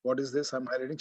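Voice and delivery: usual speaking voice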